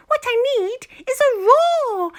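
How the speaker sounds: high, loud eureka in mouse voice; excited squeak. Acted. The mouse jumps from bed with an idea, high excited squeal. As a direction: burst out with the idea.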